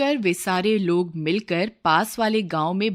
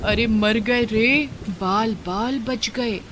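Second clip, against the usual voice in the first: panicked villager; 3 semitones higher, slower than usual. Acted. Lightning strikes near, villager panics.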